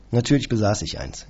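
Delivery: usual speaking voice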